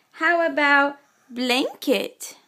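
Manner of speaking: slow, drawn-out teacher prompt. Shown as a demonstration. Mother slowly prompting Gavin to sign blanket. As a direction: stretch the question out for the child. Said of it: "drawn-out teaching prompt".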